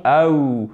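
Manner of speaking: "au" drawn out, gliding onto a final ou; demonstrated diphthong. Shown as a demonstration. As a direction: glide slowly through "au" as one double sound. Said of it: "suivi d'une diphtongue". (diphtongue « au » allongée, glissant vers ou)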